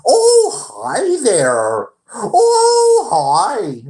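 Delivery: very high, loud squealy fake-friendly greeting; imitating the manager. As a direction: parody the boss greeting customers. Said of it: "That's how he talked".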